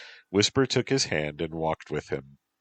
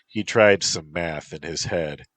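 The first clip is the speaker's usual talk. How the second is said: ordinary delivery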